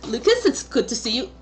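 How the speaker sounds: a smiling naked shapeshifter greeting everyone; chirpy, giggly hello; cheerful stranger. Acted. Lucas introduces himself, smiling.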